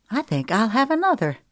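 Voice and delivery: casual, light woman's voice; lightly voiced Paulette.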